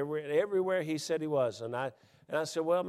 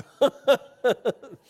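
loud burst of laughter; 11 semitones higher, 13 dB louder than usual. Real, not acted. Big laugh after joke.

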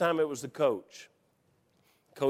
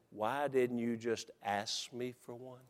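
hushed, slow, low retold question; low, close-up coach; 6 semitones lower, 12 dB quieter, slower than usual. Coach up close to a walk-on player, quiet and slow. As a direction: lean in and say it quietly. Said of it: "He looked at me real close".

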